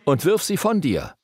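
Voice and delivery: urgent, insistent. Acted. Jesus warns in the Sermon on the Mount, mildly urgent. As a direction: press each command home, pausing before it. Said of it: "in der Bergpredigt so eindringlich".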